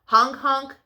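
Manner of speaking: ordinary delivery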